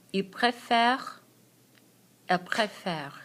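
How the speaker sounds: Je préfère said slowly, with a rolled-back French r and open è; teacher slowly modelling préfère forms to repeat; slow model pronunciation. Shown as a demonstration. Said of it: "répétez après moi".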